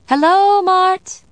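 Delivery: high, loud call through the house. A narrator's reading. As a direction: call out to someone in another room. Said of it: "I called into the house".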